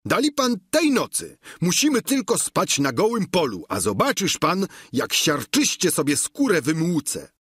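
high-pitched, eager servant voice; Sancho. A narrator's reading. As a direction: play the squire pitched well above the narration. (wysoki głos giermka)

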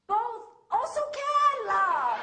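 high, loud, punchy local-English phrase; Malaysian English flourish. Shown as a demonstration. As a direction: proudly declare it in local English. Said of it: "So I say".

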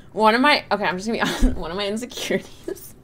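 ordinary talk

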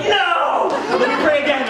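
high laughing protest, then an urgent plea to pray again; comic shocked no. Retold or imitated. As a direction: blurt it out in mock disbelief. Prayer failed, comic disbelief.

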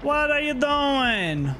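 slow, high drawn-out What are you doing; mock baffled. Killer taunting survivor, mock puzzled. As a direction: tease a clumsy opponent.

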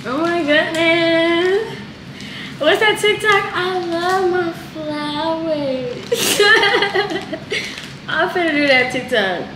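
delighted gushing. Real, not acted. Delighted with her flowers.

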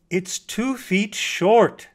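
slow, loud, high, insistent correction; a little impatient. A narrator's reading. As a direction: spell it out loudly, losing patience. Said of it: "said the mockingbird a little impatiently".

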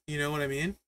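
ordinary talk

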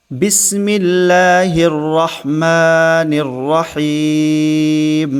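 slow, drawn-out tajweed chant; Quranic recitation. Shown as a demonstration. Teacher reciting the basmala for students, slow chant. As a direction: recite it slowly and melodically.

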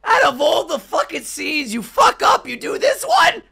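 very high, loud incredulous shouting; worked-up high-pitched rant; outraged disbelief. Real, not acted. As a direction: yell in disbelief at a botched job. Outraged at botched Russian dub.

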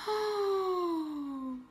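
high, sudden little oh; a quick, high gasp of realisation; startled oh. Retold or imitated. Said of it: "And I was like".

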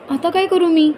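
put-on high whiny call of a bored boy; bored child calling. A narrator's reading. Boy calls out to his mother wanting something to do. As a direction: pitch it up like a kid pestering. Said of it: "यश ओरडला".

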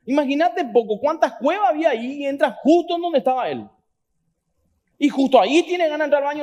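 fervent preacher, stretching key words; impassioned preaching. Real, not acted. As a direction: drive the point home to the congregation. Preaching at rising force.

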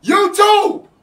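high, loud hype call to the audience; hype shout. Real, not acted. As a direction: belt it out to the stream. Streamer hyping his YouTube audience.

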